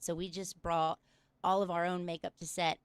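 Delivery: usual speaking voice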